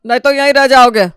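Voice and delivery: calling loudly to rouse sleepers; loud wake-up call. Acted. Father shouting his kids out of bed, loud wake-up call.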